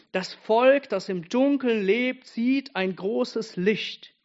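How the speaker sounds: loud, high, then slowed way down; rising up to proclaim the prophecy; fervent proclamation. Acted. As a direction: proclaim it, stretching the last words. Quoting Isaiah with fervour.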